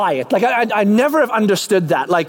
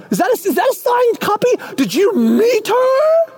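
friend noticing the signed book; amazed friend; 12 semitones higher than usual. Acted. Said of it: "you wait for them to pick up on".

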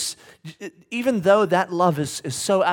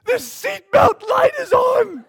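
put-on much higher, louder and slow, a little girl's cry; child's shout; 9 dB louder, slower than usual. Daughter telling her dad why she's scared, high child's shout. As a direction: wail it like a frightened kid. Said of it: "she goes".

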